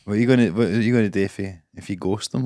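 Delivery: ordinary talk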